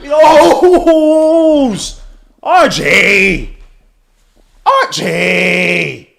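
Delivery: high, loud, drawn-out cheering yell; hyped celebration shout. Real, not acted. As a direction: holler the player's name in celebration. Celebrating a player's basket.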